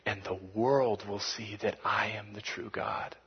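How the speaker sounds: relaxed and unbothered; quietly menacing confidence; calm confident God. Acted. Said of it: "God's saying".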